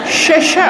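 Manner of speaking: laughing "Shesha" with sh; sya sound. Shown as a demonstration. Teacher laughing through the sya demo. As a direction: stretch the sh in "Shesha". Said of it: "pakai huruf sya".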